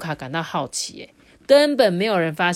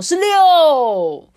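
loud and high, called out proudly; loud answer; 9 semitones higher, 10 dB louder than usual. A narrator's reading. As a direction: shout the answer across the classroom. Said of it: "卡夫卡就很大声说". (在课堂上大声喊出答案)

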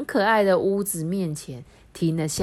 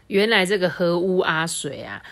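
plain reading voice

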